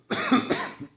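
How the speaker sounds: real dry cough. Real, not acted. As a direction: cough hard, unvoiced. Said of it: "I've been coughing more lately".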